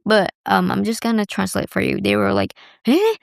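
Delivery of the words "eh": amazed disbelief. Retold or imitated. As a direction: squeal of surprise at her age. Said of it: "they were saying that in Japanese".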